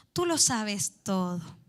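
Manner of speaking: slow, hushed, heartfelt; slow and soft. Shown as a demonstration. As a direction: say it slowly and gently, like a humble admission.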